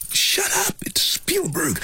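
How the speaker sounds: hushed urgent warning whisper. Retold or imitated. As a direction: hiss the warning under your breath.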